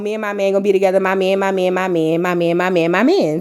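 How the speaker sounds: low, droning mock chant; mocking repetition. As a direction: rattle it off as a mocking loop. Mocking wife's repeated boast.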